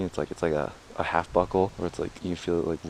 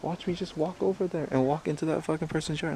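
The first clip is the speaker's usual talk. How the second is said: high squeaky mock child voice, whispering then pitched way up; voicing a toddler; 10 semitones higher than usual. Dad voicing his little son demanding to walk in yards, high mock child. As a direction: play the little boy insisting he can walk anywhere. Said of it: "he's just like".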